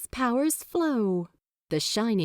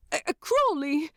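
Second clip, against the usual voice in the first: flustered angel voice; 7 semitones higher, faster than usual. A narrator's reading. Angel trying to interrupt.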